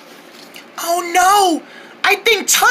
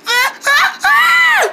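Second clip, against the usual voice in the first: frightened cries; 11 semitones higher, much slower than usual. Acted. Spider near, child panics.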